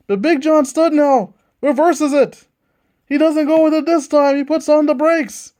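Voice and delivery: fast, punchy ringside commentary; excited wrestling announcer calling the match; wrestling play-by-play. Acted. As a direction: call every move like a live TV commentator.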